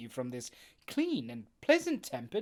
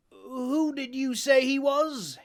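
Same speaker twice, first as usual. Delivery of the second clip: higher, slow sailor voice; Silver questioning; 11 semitones higher, 8 dB louder than usual. Acted. Silver asks Jim who it was, slower and higher. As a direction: press the boy for the name.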